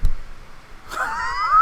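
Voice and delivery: a high squealing burst of laughter; high shrieking laugh at the mute mix-up. Real, not acted. Realizing they were muted.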